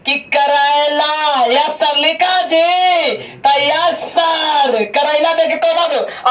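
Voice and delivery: slow, over-held words in mock dictation; drawn-out classroom dictation. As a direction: mimic dictation, stretching each word right out. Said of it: "ना कि बोल दे". (खींच-खींचकर बोला गया इमला)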